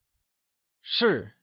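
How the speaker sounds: 是 with one firm downward fall; careful fourth-tone model of 是. Shown as a demonstration. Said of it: "is pronounced using a falling tone".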